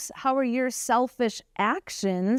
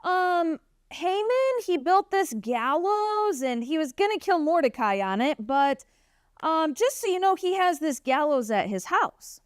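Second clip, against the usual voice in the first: high, eager servant voice; a servant sheepishly tipping off the king; high-voiced servant Harbona slipping the king some news; tattling servant; 5 semitones higher than usual. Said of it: "And he's like".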